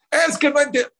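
high, loud frustrated cry; exasperated parent. Retold or imitated. Father fed up with his child, loud frustrated outburst.

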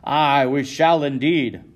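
loud, slow, emphatic yell of assent; a tavern man shouting support for Sears; agreeing yell. Acted. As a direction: bellow your agreement. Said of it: "another man yells in agreement".

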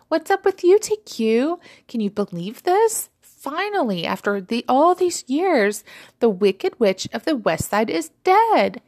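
high, loud gleeful celebration; excited crying out. A narrator's reading. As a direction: crow the news with drawn-out relish. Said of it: "Fizzy cried out".

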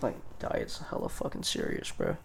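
speaking low. Real, not acted. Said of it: "I don't know why I'm speaking so low".

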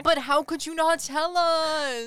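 loud, high, drawn-out wail; mock outrage. Friends to Eddie who hid cooking, mock outrage. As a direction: cry it out slowly, hammed-up betrayal.

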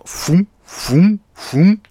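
high rising "foom" noises; floating puffs. Acted. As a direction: imitate puffing upward in steps. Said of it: "he goes floating up".

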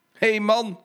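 high, loud hail to a stuck truck; conductor calling out. Acted. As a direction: call out to stop him. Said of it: "riep de conducteur".

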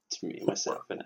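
breathy ghostly whisper; spooky voice. Pretend. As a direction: creep them out with a hushed ghost voice. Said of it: "What a spooky voice".

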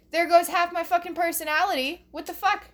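high-pitched, indignant complaint; mock outrage. Comic lamenting lost half of personality, mock outrage. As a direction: protest it high, hammed-up.

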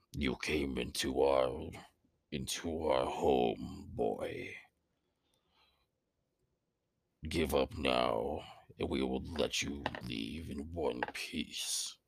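low, slow rasping stone monster; gravelly earth voice. Acted. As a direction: rasp it out like grinding gravel. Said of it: "it sounds like gravel and earth".

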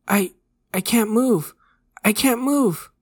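high, loud, stammering panic; panicked cry. A narrator's reading. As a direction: cry out as terror takes hold. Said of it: "he cried".

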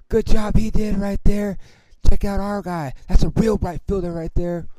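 high, very loud sarcastic heckle; yelling out. Retold or imitated. Peter heckling after the fielder bobbles the ball, yelling out. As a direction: shout it out to the whole section. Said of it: "Peter yells out".

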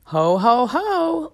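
booming Santa ho ho ho; belly-laugh like Santa Claus; jolly ho ho. Pretend. Christmas episode opening, Santa laugh.

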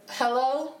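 man faking a woman's hello; put-on female voice. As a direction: answer as a woman in a high voice. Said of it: "I put on my best female voice".

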